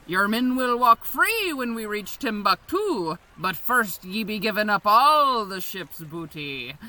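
ordinary talk

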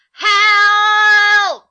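high, loud desperate call; shouting for help. Acted. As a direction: scream for someone to come. Glued to the bed, calling out.

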